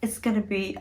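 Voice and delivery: normal talking voice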